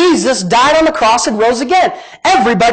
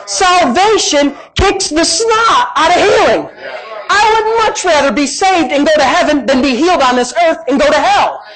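raised pitch, slow stretched key words; emphatic preaching; 4 semitones higher, slower than usual. Real, not acted. Preacher arguing salvation outdoes healing, emphatic preaching. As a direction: drive the argument home to the congregation, drawing out big words.

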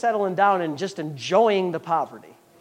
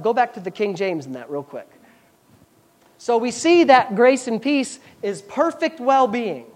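normal talking voice

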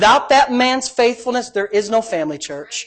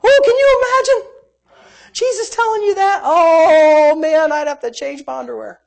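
awed exclamation; 9 semitones higher, 5 dB louder than usual. Real, not acted. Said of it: "awed comic exclamation".

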